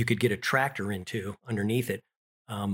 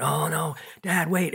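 put-on alarmed child; 6 semitones higher than usual. Child sees brother hurt.

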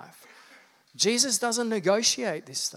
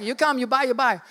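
lightly mimicked Balinese seller, pitched higher; bad Balinese accent; 6 semitones higher, faster than usual. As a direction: coax a tourist in a rough Balinese accent. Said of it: "that was meant to be Indonesian, Balinese".

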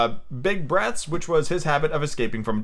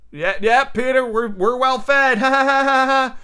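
high, loud forced cheer into fake laugh; fake nervous laughter; 10 semitones higher, 4 dB louder, slower than usual. Boys pretending to Peter they are well fed, nervously faking. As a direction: overdo the agreement, then laugh desperately.

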